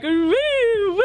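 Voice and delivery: high-pitched tipsy "woo"; giggly retold drunken cheer; tipsy whoop. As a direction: whoop like someone a few drinks in. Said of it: "I have these two drinks in me".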